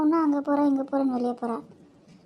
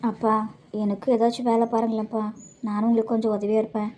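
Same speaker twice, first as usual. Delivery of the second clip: earnest grown daughter; 6 semitones lower than usual. Acted. Daughter asking father to find her work, low sincere plea.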